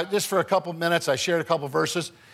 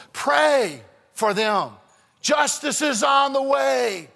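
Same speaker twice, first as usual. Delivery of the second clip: preaching shout; 7 semitones higher, slower than usual. Real, not acted.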